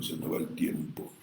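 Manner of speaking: a low unvoiced whisper to himself; whispered aside about the time. Real, not acted. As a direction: mutter it off to the side.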